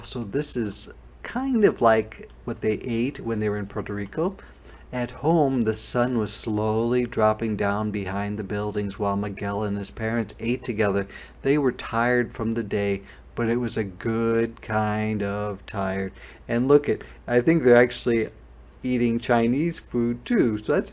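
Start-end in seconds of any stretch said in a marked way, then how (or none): none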